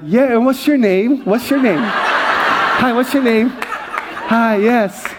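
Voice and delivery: hammed-up star greeting through laughter; laughing self-mockery. Pastor mocks himself greeting fans, laughing throughout. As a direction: play the big shot while cracking up. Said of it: "I'm like".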